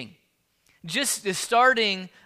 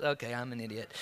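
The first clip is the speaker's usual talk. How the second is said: much lower, softer mock mumble to oneself; self-deprecating mutter; 10 semitones lower, 11 dB quieter, faster than usual. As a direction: mumble it down like you feel foolish. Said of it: "That would be my natural response".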